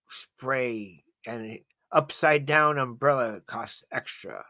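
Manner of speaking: slow car commercial voice; announcer showing off a car; ad read. Pretend. Mock car advert narration.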